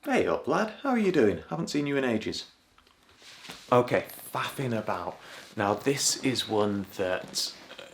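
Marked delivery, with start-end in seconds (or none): 0.0-2.5 cheery Yorkshire hello, pitched up; friendly Yorkshire hail to an old friend; Yorkshire greeting. Shown as a demonstration. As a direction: greet a mate in broad Yorkshire. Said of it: "someone greeting another person with this phrase".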